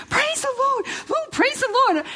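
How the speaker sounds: very high, jubilant gospel-style praise; insisting nurse. Retold or imitated. Nurse insisting over the doctors, very high joyful praising. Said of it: "she just kept insisting".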